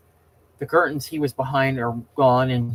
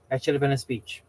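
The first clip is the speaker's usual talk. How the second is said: usual speaking voice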